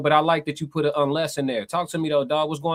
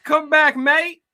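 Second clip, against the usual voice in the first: calling out; 12 semitones higher, 4 dB louder, much slower than usual. Real, not acted. Host calling after departed guest.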